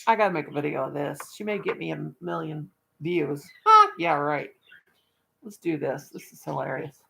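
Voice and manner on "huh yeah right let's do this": ordinary talk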